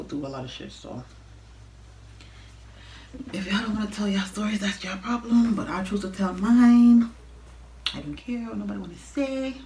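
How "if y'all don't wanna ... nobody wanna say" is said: louder and higher, defiant; defiant outburst. Real, not acted. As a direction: declare it loud and proud. Defiant rant at viewers.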